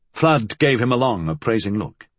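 ordinary delivery, nothing marked

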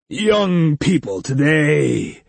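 slow, booming jolly big man. A narrator's reading. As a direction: boom it out, amused. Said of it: "boomed a jolly male voice".

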